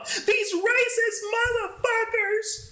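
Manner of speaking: slow, high mock drunk whine; high drunk girl voice. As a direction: drag it out like the drunk friend. Drunk friend voiced in story.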